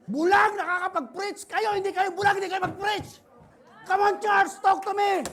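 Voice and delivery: high, loud, slow preacher's cry; rally the church with each word; shouted preaching. Acted. Preaching at full force. (sumisigaw na pangangaral)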